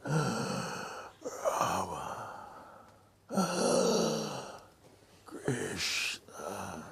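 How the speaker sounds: gasping out names between breaths; dying gasps. Retold or imitated. Dying father calling his sons with gasping breaths.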